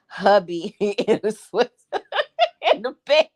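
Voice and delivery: words broken up by giggles; laughing. Real, not acted. Joking to women viewers about hubby and night sweats, laughing. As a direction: laugh your way through the line.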